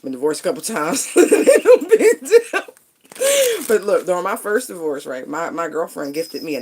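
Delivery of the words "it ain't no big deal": laughing through the words; words breaking into a laugh. Real, not acted. Laughing at her own divorce joke while talking.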